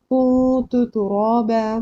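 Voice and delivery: कुतु तुराबा slowly, long nasal hum in कुतु; ikhfa recitation. Shown as a demonstration. Teacher showing how to read it, nasal hold. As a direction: draw out कुतु, hiding the noon in the nose. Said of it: "इसको पढ़ेंगे कैसे".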